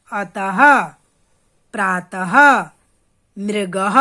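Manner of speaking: "अतः, प्रातः, मृगः" each ending on a strong "ha"; visarga ha-sound. Shown as a demonstration. Teacher showing children Sanskrit visarga words "अतः, प्रातः, मृगः", emphatic final ha. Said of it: "पीछे हौ की साउंड देगा".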